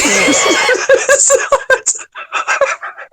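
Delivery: high, squealing laugh; helpless laughter. Real, not acted. Guest lost in the squirrel joke, laughing helplessly. Cracking up over silly image.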